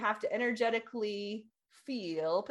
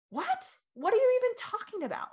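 high-pitched mock disbelief; incredulous squawk of confusion, played up; baffled husband; 12 semitones higher than usual. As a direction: imitate an exasperated, thrown-off husband, voice shooting up. Acts out husband's baffled reaction.

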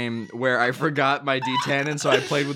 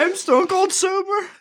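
after laughing hard. Real, not acted. Still laughing, joking.

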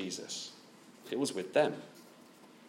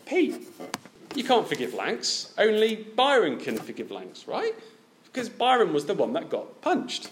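indignant protest; 8 semitones higher than usual. Pretend. Indignant listener objecting to preacher.

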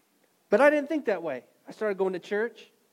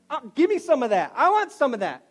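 eager woman; 5 semitones higher, 6 dB louder than usual. Acted. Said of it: "guess what she says".

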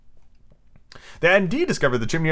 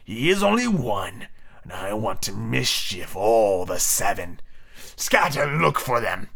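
slow, drawn-out villain captain; slow, menacing pirate chief; dark Hook; 2 semitones lower, slower than usual. Acted. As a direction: give orders darkly, stretching the words. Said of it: "Hook said darkly".

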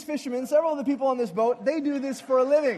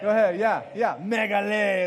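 ordinary talk

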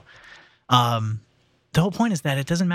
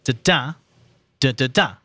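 "Da, da, da, da, da" stressed on second and last; iamb then anapest. Shown as a demonstration. Host teaches limerick metre, "Da, da, da, da, da" as da-DA, da-da-DA. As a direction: beat out "Da, da", pause, "da, da, da", each ending stressed. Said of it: "it's first an I am".

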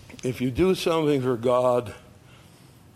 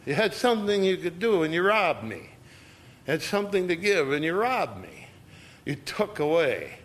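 higher, pained accusation of being robbed; God accusing; 6 semitones higher than usual. Acted. God reproaching his people, raised and hurt. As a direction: voice God confronting the people who withheld. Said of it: "God to say to us".

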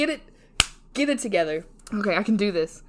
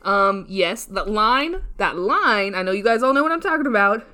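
ordinary talk, nothing marked

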